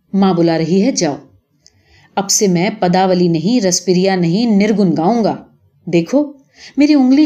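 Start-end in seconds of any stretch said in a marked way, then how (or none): none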